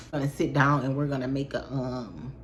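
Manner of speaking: normal talking voice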